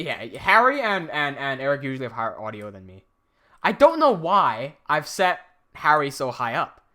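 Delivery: usual speaking voice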